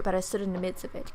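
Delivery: plain reading voice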